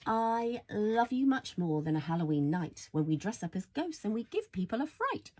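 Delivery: plain reading voice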